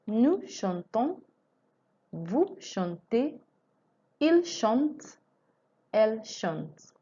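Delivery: slow recited list with rising ends; conjugation drill. Shown as a demonstration. Teacher drills chanter conjugation for students. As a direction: chant the forms one by one for repetition. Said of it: "recites conjugation drill".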